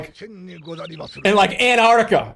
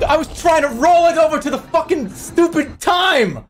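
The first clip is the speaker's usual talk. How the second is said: frustrated yell; 10 semitones higher than usual. Real, not acted.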